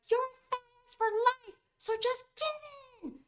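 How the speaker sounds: crazy taunting voice. Acted. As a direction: taunt in a squeaky, bratty voice. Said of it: "It can be crazy. It could be like".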